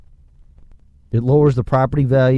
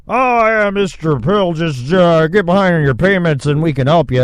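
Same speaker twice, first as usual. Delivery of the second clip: sugary fake-friendly lender, pitched higher and louder; mocking lender; 5 semitones higher, 4 dB louder than usual. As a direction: mimic a slick lender luring you in. Mimics lender's promise.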